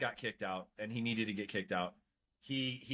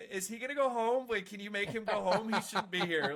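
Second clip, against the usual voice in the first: nervous high voice; 8 semitones higher, 4 dB louder than usual. Retold or imitated. Nervous whispered recruit thoughts.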